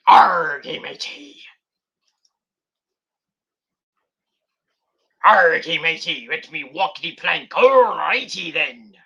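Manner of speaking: a hint of slurred, slow pirate; drunk pirate. Imitating how the wrestler sounds, a light drunk pirate. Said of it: "Sound like a drunk pirate".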